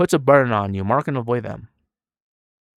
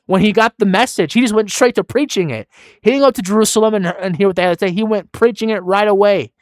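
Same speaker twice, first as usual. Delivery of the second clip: louder, higher, driving sermon voice; full-force preaching; 5 semitones higher, 5 dB louder than usual. Acted. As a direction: preach it with rising passion.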